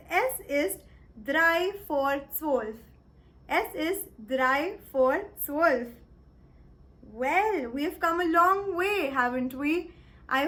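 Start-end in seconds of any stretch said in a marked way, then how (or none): 0.1-2.7 careful, slow German; "drei vor zwölf" said slowly and clearly; slow German demo. Shown as a demonstration. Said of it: "You'll say it as". 3.5-5.9 careful, slow German; "drei vor zwölf" said slowly and clearly; slow German demo. Shown as a demonstration. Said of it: "You'll say it as".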